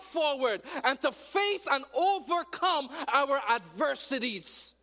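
loud, high, rising sermon cry; full-force preaching. Real, not acted. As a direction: preach it hard to the crowd.

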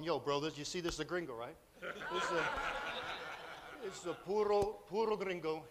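a hint of swaggering bee gang voice; bees talking. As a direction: play the bees as street toughs plotting. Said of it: "And they're actually talking".

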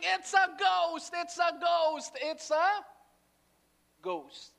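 high, drawn-out, loud cries; panicked shouting. Acted. Terrified men running around in a boat, panicked shouting. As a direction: shout it over and over in alarm.

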